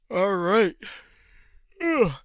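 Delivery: shouted, high all right and ugh; loud frustrated groan. Real, not acted. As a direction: blurt it out in frustration. Reacting to the game.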